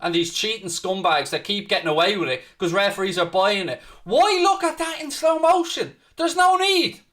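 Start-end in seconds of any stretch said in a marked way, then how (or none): 4.0-7.0 exasperated rant. Real, not acted. Ranting at referee decision.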